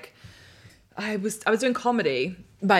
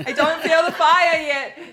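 louder and higher, laughing; say it with your chest; 11 semitones higher, 11 dB louder, slower than usual. Shown as a demonstration. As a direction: belt it out, half laughing. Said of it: "Say it with your chest".

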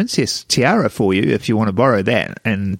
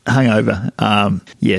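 ordinary delivery

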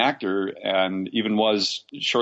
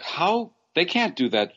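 retold disbelief; 7 semitones higher than usual. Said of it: "and I was like".

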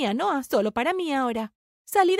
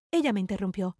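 usual speaking voice

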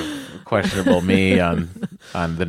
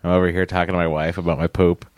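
usual speaking voice

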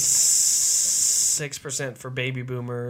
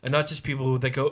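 ordinary delivery

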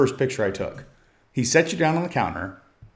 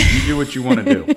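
mock voice; 13 semitones higher, 3 dB louder than usual. Teasing mock voice.

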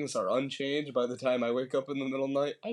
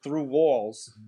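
put-on Boston accent; 2 semitones higher, 4 dB louder than usual. Character insists loudly.

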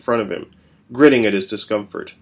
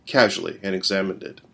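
plain reading voice

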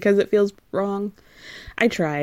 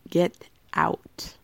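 whispered warning, softer; mock whisper; 5 dB quieter than usual. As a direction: whisper it like a dramatic aside. The streets' verdict, dramatic aside.

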